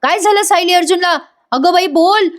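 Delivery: high-pitched, anxious questioning; acted fright. Kalpana begs for news of Sayali and Arjun, acted fright. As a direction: imitate a frightened mother pressing for news. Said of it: "कल्पनाताई घाबरतात".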